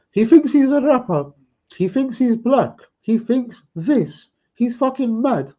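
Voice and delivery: very high, squeaky mimicking voice; mocking imitation. Rival's same old reply on hearing his name, mocked in falsetto. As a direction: parrot his stock reply in a loud falsetto. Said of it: "He replies the same every time".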